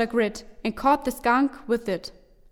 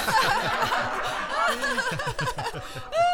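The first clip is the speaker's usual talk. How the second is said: very high laughing fit; high giggling fit; high laughter; 13 semitones higher, 3 dB quieter than usual. Acted. Friends laugh at villain.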